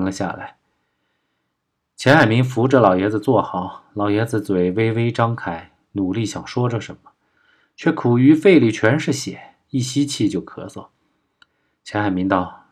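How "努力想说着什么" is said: plain reading voice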